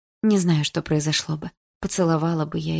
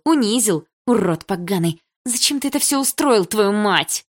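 raised, agitated reproach; angry accusation; 5 semitones higher, 3 dB louder than usual. Acted. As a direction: lash out in outrage.

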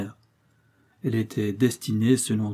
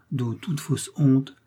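ordinary delivery

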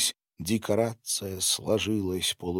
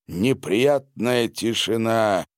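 usual speaking voice

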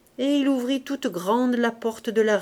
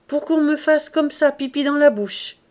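plain reading voice

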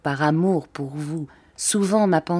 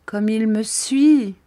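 slow, higher, louder in despair; a cry of hopeless despair, drawn out; 6 semitones higher, 4 dB louder, slower than usual. Acted. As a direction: Henriette despairs at being followed, slow anguished cry. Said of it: "avec l'accent du désespoir".

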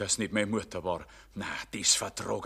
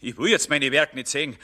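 loud, higher, anxious protest; agitated loud refusal; 8 semitones higher, 7 dB louder than usual. Acted. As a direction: fend her off in panic. Jedermann agitated, pushing her away.

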